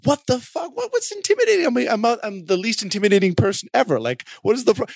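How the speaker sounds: mock outrage. Founder retelling his reaction to being called intimidating, mock outraged squeak. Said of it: "outraged by that".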